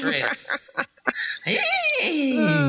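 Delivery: high, eerie basilisk voice; creepy snake voice. Acted. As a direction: speak it like a lurking snake. GM voicing basilisk for fun.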